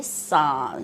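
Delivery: slow, clear سانس with the n sounded; correct pronunciation. Shown as a demonstration. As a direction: teach the word سانس, drawn out with its n. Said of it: "نون لگانا بھول جاتے ہیں".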